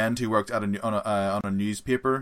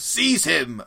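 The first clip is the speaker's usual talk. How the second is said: villain command; 7 semitones higher, 6 dB louder than usual. Retold or imitated. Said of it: "the evil guy goes".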